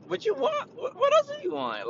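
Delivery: high squeaky what else do you want; mock incredulous squeal. To the greedy customer, squeaky mock disbelief.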